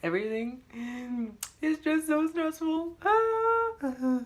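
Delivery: high, whimpering mock sob; fake crying. Telling viewers she's crying real tears, in a high mock sob. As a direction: whine it out like a hammed-up cry. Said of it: "Look, I'm crying real tears".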